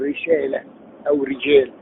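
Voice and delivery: رسالة and رجال with thin, light R. Shown as a demonstration. Teacher demonstrates kasra to students, R in رسالة and رجال light. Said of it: "ترقيق".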